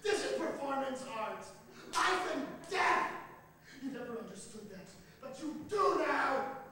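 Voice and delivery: high, loud and slow proclamation; Stacy shouting at the drowning senator; fierce shout. A narrator's reading. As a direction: declare it like a manifesto.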